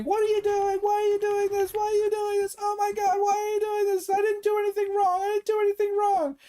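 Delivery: very high squealing hysterical wail; mock screaming. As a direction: shriek it like she's losing it. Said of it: "She started screaming".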